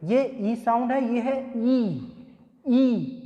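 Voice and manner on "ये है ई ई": "ई" stretched long and slow; "ई" held long with stress; long ee sound. Shown as a demonstration. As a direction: model "ई" as a drawn-out ee. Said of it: "stress देकर".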